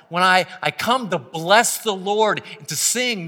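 high, loud, fervent preaching; preaching at full force. Acted. Pastor urging the church to gather, fervent loud preaching. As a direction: lift the congregation, pressing hard.

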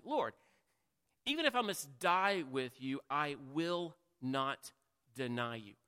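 NOT drawn out very slowly; slow right down on "I will not"; emphatic vow. Acted. Peter insisting to Jesus, emphatic and slowed on not. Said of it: "he says emphatically".

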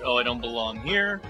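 ordinary delivery

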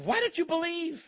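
high, exasperated retold question; frustrated. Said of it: "he's frustrated with the disciples".

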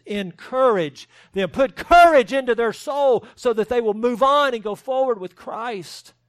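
rally the congregation forward; urgent exhortation. Acted. Preacher urging the faint-hearted church, rising loud exhortation.

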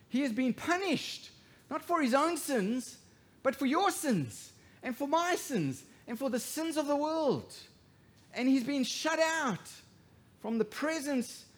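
high, loud preaching; rising, forceful sermon climax; full-force preaching. Acted. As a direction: drive the point home.

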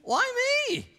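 put-on very high, cracking voice of a twelve-year-old; squeaky breaking boy voice. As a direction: squeak it out like a boy whose voice is changing. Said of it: "in my squeaky, changing voice".

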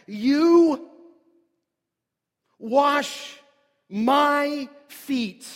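"You" punched high and loud, then "wash my feet"; emphatic you. Shown as a demonstration. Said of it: "what we would say in the language emphatic".

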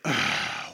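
heavy mock sigh; put-on weary sigh; exasperated sigh. Acting out Mary's exasperation.

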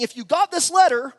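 high, bright mock sales pitch; hyped cruise pitch. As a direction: ham up the too-good-to-be-true ad. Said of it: "they were saying".

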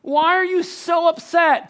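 rising, slow pleading questions, building to a near shout; a husband begging his wife to tell him what's wrong. Acted. Elkanah pleading with crying Hannah, rising to a near shout. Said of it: "her husband Elkanah said to her".

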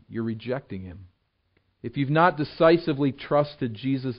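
earnest appeal. Real, not acted. Preacher urges unbelievers to trust Christ, softly earnest. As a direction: lean in and plead gently with the listener.

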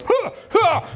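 high, startled panting bleats; panicked sheep huffs. Retold or imitated. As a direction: huff like a spooked sheep.

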